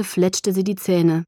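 plain reading voice

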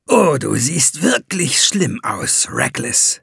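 undisguised glee at another's suffering; gloating. A narrator's reading. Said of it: "mit unverhohlener Schadenfreude".

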